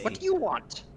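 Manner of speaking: high, wary goblin. Acted. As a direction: call out like a nervous goblin. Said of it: "He says".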